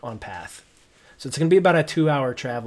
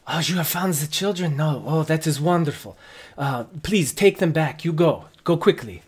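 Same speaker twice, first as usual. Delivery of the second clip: guard voice; 3 semitones higher, 4 dB louder than usual. Acted.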